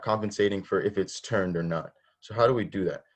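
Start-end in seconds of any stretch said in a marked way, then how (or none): none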